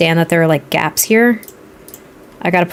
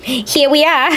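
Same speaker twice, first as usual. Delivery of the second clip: put-on higher-pitched, playful Boston accent; hammed-up Bostonian, R's dropped; 7 semitones higher, slower than usual. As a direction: sing out a broad Boston greeting. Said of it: "I just went completely Boston on everybody".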